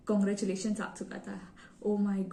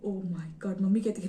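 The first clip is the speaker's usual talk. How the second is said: ordinary talk, nothing marked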